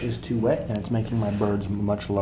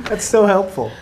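sarcastic; 8 semitones higher, 9 dB louder than usual. Pretend. Teasing about extra steps.

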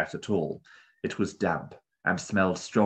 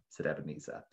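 ordinary delivery, nothing marked

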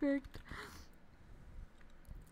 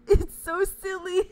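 loud, slow laughing squeal; helpless giggling delight, loud; 4 semitones higher, 12 dB louder than usual. Real, not acted. Laughing over cute gift.

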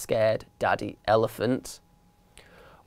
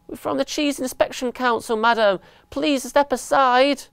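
high-pitched little official; squeaky voice of petty authority; officious mouse; 10 semitones higher than usual. A narrator's reading. Mouse inspectors ordering the mum aside, officious mouse.

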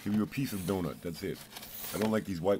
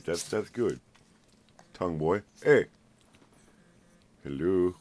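normal talking voice

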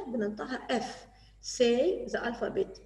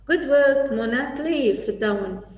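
loud praise; 2 semitones higher, 8 dB louder than usual. Pretend. Teacher praises Mona loudly.

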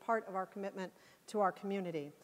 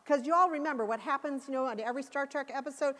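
normal talking voice

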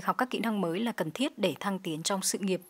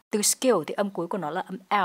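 ordinary delivery

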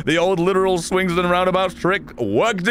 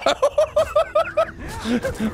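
high laugh. Real, not acted. Laughing at weird glitch.

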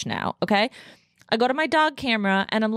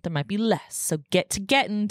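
ordinary talk, nothing marked

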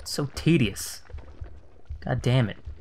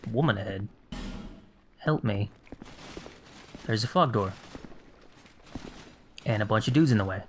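ordinary talk, nothing marked

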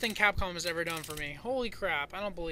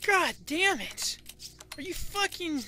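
high-pitched frustrated outburst; exasperated cursing; 8 semitones higher, slower than usual. Real, not acted. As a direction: curse in helpless disbelief. Cursing after dying.

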